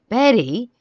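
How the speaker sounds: high, loud shocked exclamation. A narrator's reading. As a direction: blurt the name in shock. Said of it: "I said, shocked".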